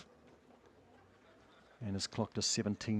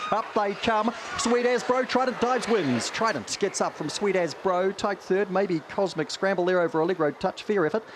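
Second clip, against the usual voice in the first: higher, urgent finish call; excited race finish; 11 semitones higher, 6 dB louder than usual. Acted. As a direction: lift it as they hit the line.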